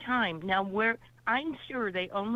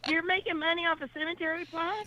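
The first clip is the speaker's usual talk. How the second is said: amazed; 7 semitones higher than usual. Real, not acted. Amazed at selling cemetery plots.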